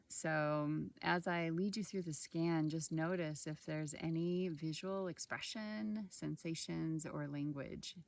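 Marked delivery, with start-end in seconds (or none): none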